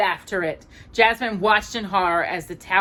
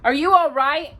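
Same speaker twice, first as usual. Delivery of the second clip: worried; 9 semitones higher, 6 dB louder than usual. Acted. Aladdin checking on Abu.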